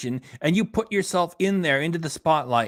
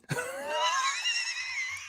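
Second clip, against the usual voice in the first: high-pitched laugh; 8 dB quieter than usual. Real, not acted. Laughing at his own stoned fries joke in a squealing burst.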